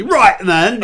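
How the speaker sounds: a hint of Cockney "Right, then"; quick Cockney aside, lightly done. Host briefly imitating a Cockney accent. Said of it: "like, the Cockney".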